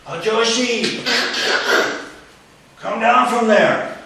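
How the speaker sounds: captain losing patience, calls him down; captain repeating order. Retold or imitated. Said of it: "no reaction".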